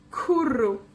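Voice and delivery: Kurru with KU stressed and falling; KU-rru, rolled r; stress on first syllable. Shown as a demonstration. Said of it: "cae en la penúltima sílaba".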